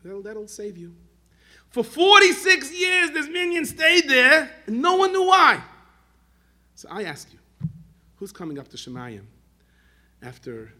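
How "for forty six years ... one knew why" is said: slow, high-pitched proclamation at full volume; impassioned shout. Real, not acted. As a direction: thunder out the climax, stretching each phrase. Climax of the preacher's story.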